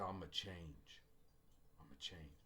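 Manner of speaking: a murmured private vow; quiet resolve. Retold or imitated. Student quietly promising her teacher she will change.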